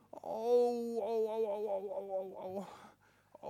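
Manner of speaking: loud high cries of pain while falling; yelping as he tumbles and hurts his foot. Acted. Boy Tobi falling down the mountain, crying out in pain.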